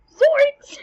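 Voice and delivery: high, loud laughing yelp; Scooby-Doo yelp. Retold or imitated. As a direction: shriek it like a scared cartoon. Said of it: "You'd be like".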